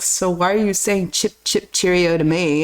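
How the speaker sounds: lightly voiced friend, low and puzzled; retold British friend. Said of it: "he's British. And he's like".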